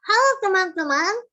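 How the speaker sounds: high, loud twisted hello; tongue to the side. Shown as a demonstration. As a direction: shift the tongue sideways as you greet. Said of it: "gerakin aja lidah kita berbeda ke sebelah sini". (sapaan dengan lidah digeser ke samping)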